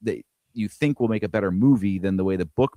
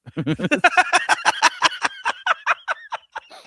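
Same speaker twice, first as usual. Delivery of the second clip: high-pitched laugh. Real, not acted. Laughing at own joke.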